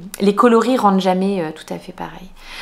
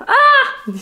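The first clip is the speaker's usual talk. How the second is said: short high-pitched "Ah!", then a giggle; playful squeal and laugh; 10 semitones higher than usual. Real, not acted. Vlogger unafraid of looking ridiculous to viewers, high playful squeal. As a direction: let out a high yelp breaking into laughter. Clowning with knitting as a hat.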